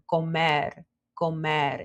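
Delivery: "comer" ending in a tapped R, near "comed"; "comer" in Spanish, final R unrolled and D-like; soft D-like R. Shown as a demonstration. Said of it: "more like a soft D sound".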